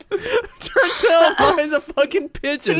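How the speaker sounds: slow, laughing through the words; can barely get it out for laughing; giggling disbelief at a friend. Real, not acted. Laughing at friend's bird claim.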